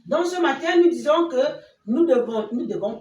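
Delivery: plus fort et plus aigu, élan de prédicatrice; conclusion forte. Real, not acted. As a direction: proclame la leçon avec ferveur. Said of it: "conclut la leçon avec force". (devant les enfants, conclusion proclamée avec force)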